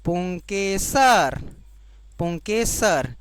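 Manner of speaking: पुंकेसर drawn out syllable by syllable, very slow; teacher dictating पुंकेसर very slowly; slow dictation. Shown as a demonstration. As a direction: spell out पुंकेसर slowly so students can write it. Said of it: "इसको बोलते हैं".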